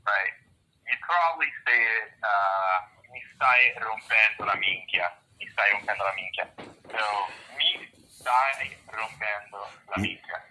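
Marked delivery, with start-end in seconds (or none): 3.1-5.1 "Mi stai rompendo la minchia" with a rolled R and clear Italian vowels; correct Italian. Shown as a demonstration. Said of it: "how would you say that correctly". 5.4-6.5 quick native take. Shown as a demonstration. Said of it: "how would you say that correctly". 7.6-10.4 slow teaching take. Shown as a demonstration. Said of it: "how would you say that correctly".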